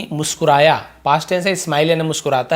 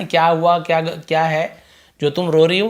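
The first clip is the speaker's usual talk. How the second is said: normal talking voice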